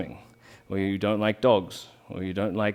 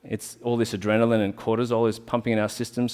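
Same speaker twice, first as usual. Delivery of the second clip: ordinary delivery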